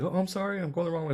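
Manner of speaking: high-pitched imitation of a sorry shopper; lightly mocked flustered lady; apologetic lady. Acts out apologetic lady in aisle.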